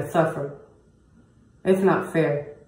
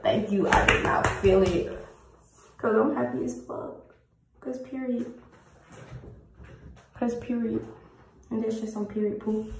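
higher-pitched, loopy retelling of being gassed; giddy on laughing gas; 6 semitones higher than usual. Retold or imitated. Patient retelling her laughing-gas moment to viewers, giddy. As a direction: imitate yourself happy on laughing gas. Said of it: "That had gave me laughing gas".

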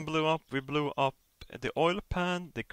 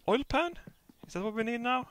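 much higher, quizzical; puzzled question; 12 semitones higher than usual. Real, not acted. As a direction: pitch it up in surprise. Puzzled at next task.

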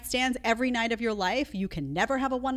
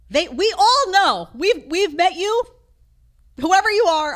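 exasperated rant; 9 semitones higher, 10 dB louder than usual. Real, not acted. Host talking back to the woman in the clip, loudly exasperated. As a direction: raise your voice and stretch the words out.

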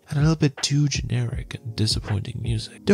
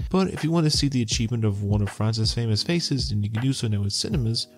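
normal talking voice

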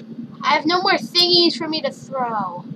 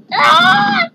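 high yell; 6 dB louder than usual. Real, not acted. Falling in game.

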